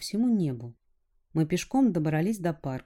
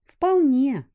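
put-on girl voice; 9 semitones higher, 9 dB louder than usual. A narrator's reading.

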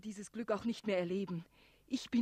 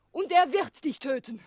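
louder, higher, hurled threat; defiant shout; 6 semitones higher, 12 dB louder than usual. Acted. Girl shouting a threat at the dragon. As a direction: throw the threat at him loudly.

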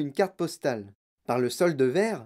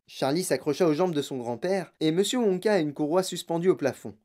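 ordinary delivery, nothing marked

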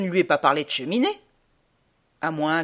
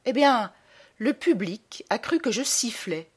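normal talking voice

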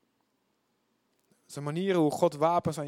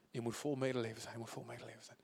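low murmur, fading softer; repeating to oneself; 5 semitones lower, 15 dB quieter, faster than usual. Shown as a demonstration. Believer rehearsing the verse in thought, murmured low. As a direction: say it under your breath, then fainter. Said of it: "herhalen voor jezelf".

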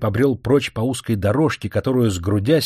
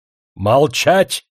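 slow, drawn-out angry bark; furious outburst; 3 semitones higher, 4 dB louder, much slower than usual. A narrator's reading. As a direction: snap it out slowly in rage. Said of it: "вспылил Демидов".

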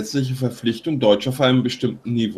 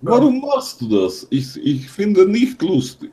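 mock broken German accent, high; russian accent in german; 7 semitones higher than usual. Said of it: "Nicht so extrem".

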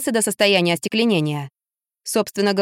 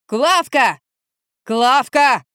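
higher, hammed-up bellow for someone; drunken yell; 4 semitones higher, 4 dB louder, much slower than usual. A narrator's reading. Drunk man yells from upstairs for Klavka, bellowing. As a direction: call out in an acted drunken yell. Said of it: "со второго этажа донёсся вопль".

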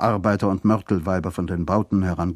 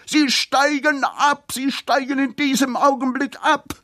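hoch und langsam gedehnt geschrien; wütendes Geschrei. A narrator's reading. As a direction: kreisch es langgezogen und außer dir heraus. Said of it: "tobte noch immer". (langsames, hohes Wutgeschrei)

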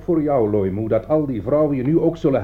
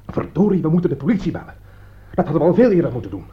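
louder, quicker exasperated push; frustrated outburst; 5 semitones higher, 5 dB louder, faster than usual. Acted. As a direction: vent your frustration. Frustrated outburst about police. (gefrustreerde uitbarsting)

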